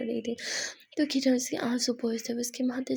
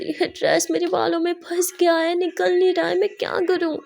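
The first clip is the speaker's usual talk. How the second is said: panicked; 7 semitones higher, 6 dB louder than usual. Acted. Simran panicking, dress stuck.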